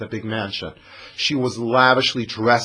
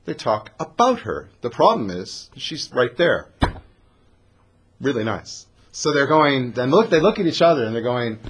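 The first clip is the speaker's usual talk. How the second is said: ordinary talk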